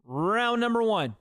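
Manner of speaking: slow, high announcer call; ring announcer. Pretend. Streamer calling the opening round, announcer voice.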